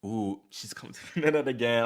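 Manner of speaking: tense, breath held in; holding breath. Real, not acted. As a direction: speak while holding your breath. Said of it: "I'm holding my breath".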